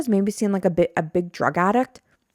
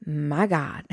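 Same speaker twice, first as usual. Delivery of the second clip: imitating shocked woman; much slower than usual. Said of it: "And she's like".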